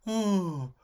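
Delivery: drawn-out oohs in a high comic voice; hammed-up impression, pitched well up; Bernard Bresslaw. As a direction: moan it out, high and daft. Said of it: "It's my Bernard Bresslaw impression".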